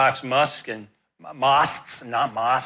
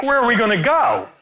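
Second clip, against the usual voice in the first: normal talking voice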